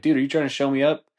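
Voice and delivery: mock angry umpire. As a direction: snap at the coach like an annoyed umpire.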